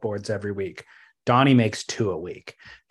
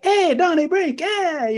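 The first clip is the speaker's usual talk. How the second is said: mocking a fake Irish-pirate accent, pitched way up; bad leprechaun accent; 3 dB louder, slower than usual. [name] razzing Donnie as he walks in, hammed-up leprechaun accent. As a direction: razz him in a botched leprechaun brogue. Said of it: "it was a leprechaun".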